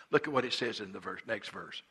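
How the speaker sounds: ordinary delivery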